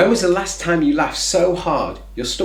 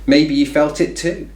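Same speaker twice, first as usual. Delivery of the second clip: ordinary talk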